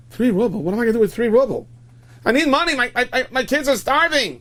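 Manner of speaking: high, loud, outraged pleading; worked-up plea from a needy man; desperate protest. Acted. Acted beggar protesting the sum.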